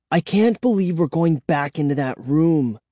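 plain reading voice

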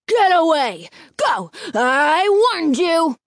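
high, loud, slow threat; angry snarl. A narrator's reading. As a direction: snarl at the kids. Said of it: "Mister Chesney snarled".

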